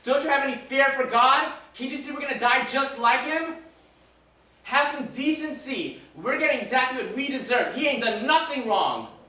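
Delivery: high, loud scolding shout; angry yelling. Acted. Dying robber rebuking Jake for mocking Jesus, angry yell. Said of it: "I yelled at him".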